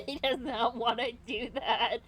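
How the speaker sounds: talking through loud laughter; words breaking up with real laughter; real laughing. Real, not acted. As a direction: laugh right through the line. Laughing out of character.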